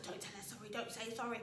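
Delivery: imitating a quiet aside of disapproval; hushed objection. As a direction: say it under your breath. Partner forbids the apology, hushed.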